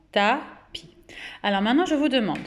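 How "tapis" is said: "tapis" as ta, pause, pi; detached teacher's "ta-pis"; syllable by syllable. Shown as a demonstration. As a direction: count out the two syllables of "tapis". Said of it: "Deux syllabes".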